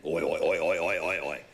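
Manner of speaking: low, loud mock pig grunting; hog grunts. Telling the host about his uncle's hogs, grunting like them. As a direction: grunt out the hogs' oi oi like a farm boy.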